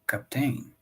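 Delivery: "Captain" with stress on the last syllable, tain long. Acted. Rider naming himself, cap-TAIN with final stress. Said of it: "He gave emphasis to the last syllable".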